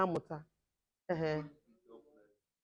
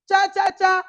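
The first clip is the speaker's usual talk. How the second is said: high, very loud crowd call; rousing call for a group answer; rallying shout; 8 semitones higher, 15 dB louder, slower than usual. Real, not acted. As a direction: shout the chant, stretching the last word. Rallying call, audience answers Yeah.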